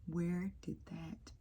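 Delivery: slow baffled whisper; hushed disbelief. Real, not acted. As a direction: breathe it out in disbelief.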